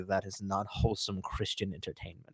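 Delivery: put-on low, soft, stern old-man voice; a grandfather quietly scolding the grandson; disapproving grandfather. Grandfather disapproving his grandson's videos, low and stern. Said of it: "my grandfather was just like".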